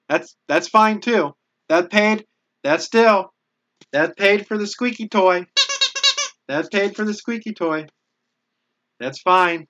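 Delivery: high, loud, delighted repetition; gushing thanks for paying for the squeaky toy; excited thanks. Real, not acted. Thanking a donor for the squeaky toy, delighted.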